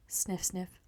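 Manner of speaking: breathy whispered sniffing. Acted. Raccoon sniffing again, whispered.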